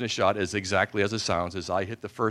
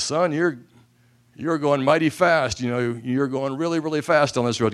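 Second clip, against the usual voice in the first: drawling cop; 6 semitones higher, 5 dB louder than usual. Retold or imitated. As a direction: play a stern country cop. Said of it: "he says".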